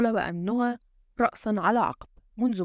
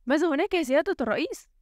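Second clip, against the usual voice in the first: anxious, higher-pitched aide's query; worried question; 7 semitones higher, faster than usual. A narrator's reading. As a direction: ask with concern, voice lifting at the end. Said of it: "سأله كبير السعاة في قلق". (سؤال قلِق بنبرة مرتفعة)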